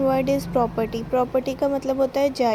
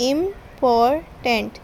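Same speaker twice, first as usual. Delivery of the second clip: Important drawn out very slowly; slow syllable count; much slower than usual. Shown as a demonstration. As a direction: spell out Important beat by beat for the class. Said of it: "So important is a three syllabic word".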